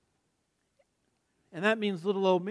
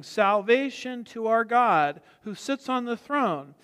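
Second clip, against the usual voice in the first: loud, slow, drawn-out declaration; loud proclamation; 3 semitones higher, 3 dB louder than usual. Acted. Preacher quoting the martyrs' cry in heaven, loud proclamation. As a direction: proclaim it slowly to a whole crowd. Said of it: "cry out with a loud voice".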